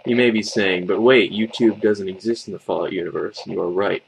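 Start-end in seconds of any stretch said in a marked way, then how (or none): none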